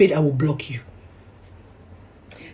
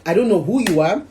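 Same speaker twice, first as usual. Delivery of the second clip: usual speaking voice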